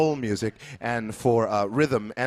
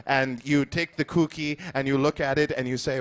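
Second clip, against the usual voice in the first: ordinary talk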